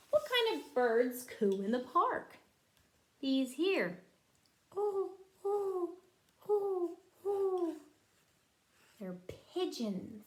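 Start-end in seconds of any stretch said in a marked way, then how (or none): 4.8-7.7 pigeon coo. Retold or imitated. Pigeon coo imitated for child.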